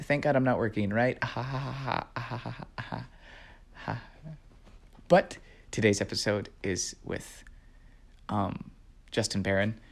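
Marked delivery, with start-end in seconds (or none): 1.2-4.0 soft trailing laugh. Real, not acted. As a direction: laugh it off, fading. Laughing at own joke.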